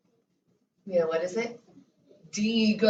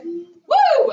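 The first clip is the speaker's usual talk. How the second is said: excited cheer; 10 semitones higher, 7 dB louder than usual. Real, not acted. Teacher cheers the next name.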